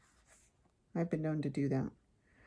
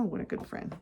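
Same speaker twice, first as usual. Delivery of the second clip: ordinary delivery, nothing marked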